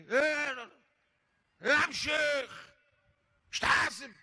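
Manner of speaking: high shouting rebuke of the sheikh; angry yelling driver. Retold or imitated. Driver shouting angrily at the sheikh after the crash. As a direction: yell at him like an angry driver. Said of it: "لا، وزعيق".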